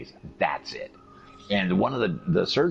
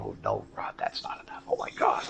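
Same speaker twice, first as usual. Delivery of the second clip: hushed, breathy mock panic; mock whisper; 10 semitones lower, 7 dB quieter than usual. Mocking the surgeon's dismay, whispered. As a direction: whisper it like a fake gasp of horror.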